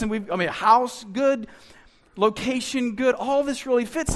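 hammed-up smug listing of blessings; smug content believer. Mocking a comfortable believer.

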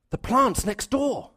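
the horror of remembering too late; sudden realization. Retold or imitated. Exhausted couple suddenly remembering the plants, alarmed and slow.

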